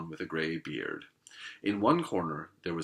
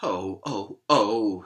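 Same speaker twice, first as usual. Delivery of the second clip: sobbing; 7 dB louder, much slower than usual. Acted. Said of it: "sobbed Mister Tumnus".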